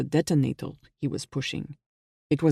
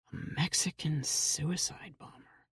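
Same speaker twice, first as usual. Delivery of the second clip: whispered, under the breath; muttered to himself; 10 dB quieter than usual. A narrator's reading. Jack walking down the hallway mutters to himself in a whisper. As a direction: murmur it to yourself, barely voiced. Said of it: "he muttered to himself".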